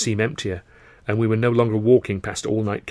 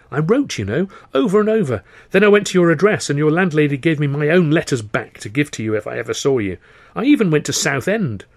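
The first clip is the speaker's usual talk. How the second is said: raised, high-pitched outpouring of grievance; aggrieved protest; 6 semitones higher, 6 dB louder than usual. Acted. Telling a lost friend of unanswered letters, aggrieved. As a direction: insist hotly, pitch jumping up.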